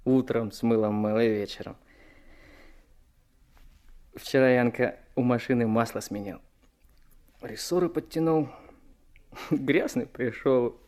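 usual speaking voice

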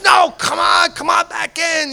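high-pitched urging, retold; insistent coaxing. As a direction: coax a friend back in, pitch lifted. Acts Jorge urging him back inside.

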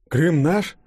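high, rising surprised question; taken aback, voice lifting sharply; astonished question. A narrator's reading. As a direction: ask it in puzzled disbelief. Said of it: "удивлённо пробормотал Денис".

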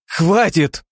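high, loud snapped shout; angry bark. A narrator's reading. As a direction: cut them off with a bark. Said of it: "внезапно рявкнула Алина".

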